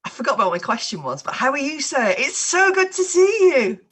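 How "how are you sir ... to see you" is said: delighted. Real, not acted. Delighted greeting of an old friend.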